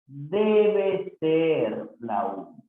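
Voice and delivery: very slow, drawn-out "Debe ser"; teacher's slow, measured repeat; slow careful correction. Shown as a demonstration. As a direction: stretch out "Debe" and "ser" deliberately. Teacher slowly repeats corrected sentence.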